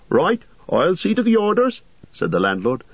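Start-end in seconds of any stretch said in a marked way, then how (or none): 0.1-1.8 higher, fussy innkeeper voice; innkeeper Butterbur. Acted. Landlord gossiping to Frodo about the ranger, raised fussy voice. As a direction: chatter like a nervous landlord.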